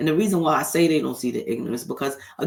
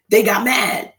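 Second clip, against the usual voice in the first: mock outrage; 8 semitones higher, 8 dB louder, slower than usual. Mock surprise at their anger.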